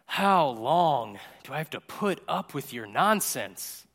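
slow, drawn-out exasperation; exasperated sigh. Retold or imitated. Jesus to his failed disciples, wearily exasperated. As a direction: drag it out like an eye roll. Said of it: "rolls his eyes and sighs".

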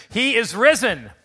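loud Easter call. Shown as a demonstration. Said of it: "Let's try it one more time".

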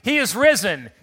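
loud, high proclamation; emphatic call. Shown as a demonstration. Pastor calling to standing congregation, loud and high. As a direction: proclaim it with full force. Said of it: "Let's give it some emphasis".

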